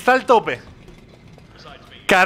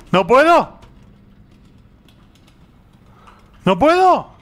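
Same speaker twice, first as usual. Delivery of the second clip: louder, higher frustrated outburst; frustrated cry; 7 semitones higher, 5 dB louder, slower than usual. Real, not acted. Player stuck on the puzzle, loud frustrated cry. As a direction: shout it out, fed up with the puzzle.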